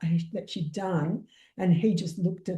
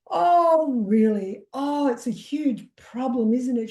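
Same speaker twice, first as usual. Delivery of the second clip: pitched-up, overplayed "oh really" shock; mock alarm; 5 semitones higher, 4 dB louder than usual. Healer retelling her reply to a panicked client, mock shocked. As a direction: feign big surprise to draw her out.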